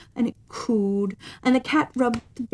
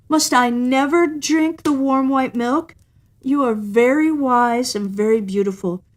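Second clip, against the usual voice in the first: mock sorry; 6 semitones higher than usual. A narrator's reading. Cat pretending sorrow to win the woman. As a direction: pretend pitiful wheedling. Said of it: "the cat pretended to be sorry and said".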